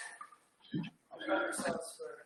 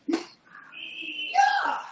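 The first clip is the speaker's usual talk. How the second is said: high yell of exertion; effort shout; 8 dB louder than usual. Real, not acted. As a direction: push out a triumphant yell. Finishing final hard rep.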